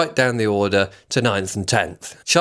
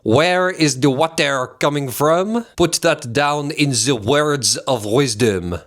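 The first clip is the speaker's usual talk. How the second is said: slow, hammed-up cartoon French accent; 2 semitones higher, slower than usual. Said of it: "cartoon impression of a Frenchman".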